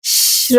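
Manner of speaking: drawn-out "shh"; shushing. Acted. Ravi hushing the lady in the park, shushing. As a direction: hush someone with a long shh.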